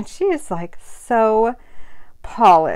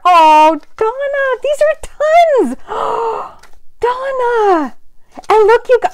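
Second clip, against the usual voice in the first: high, loud, drawn-out squeals of astonishment; overwhelmed excitement; 12 semitones higher, 6 dB louder, slower than usual. Real, not acted. To Donna on finding tons of twos, overwhelmed excitement. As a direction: squeal in real amazement at the gift. Overwhelmed by friend's money gift.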